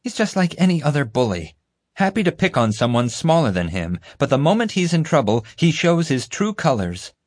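high-pitched scornful verdict; disgusted. A narrator's reading. Mother judges the crying bully, disgusted. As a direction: sneer at the crybaby bully. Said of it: "Mom said in disgust".

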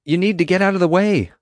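high, loud instruction; urgent calling out. A narrator's reading. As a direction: call across to someone in a tree. Calling across to friend in tree.

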